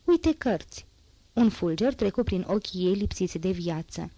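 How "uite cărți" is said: high-pitched surprised exclamation. A narrator's reading. Girl noticing books on the table, surprised. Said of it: "grăi ea surprinsă".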